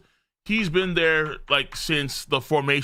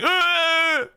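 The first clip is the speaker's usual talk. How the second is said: long, high exaggerated wail; mock crying wail; 3 dB louder than usual. Reacting to everyone crying, mock high wail. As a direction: wail like a hammed-up crybaby.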